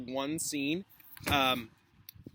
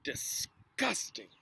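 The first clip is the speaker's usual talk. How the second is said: disgusted; 4 dB quieter, much slower than usual. Acted. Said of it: "pulled a face".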